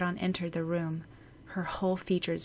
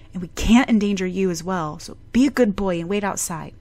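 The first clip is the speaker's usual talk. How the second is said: lightly voiced doctor, louder, talking down to a child; patronizing doctor; 3 semitones higher, 8 dB louder than usual. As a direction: send the boy away kindly but firmly. Doctor dismissing the little boy.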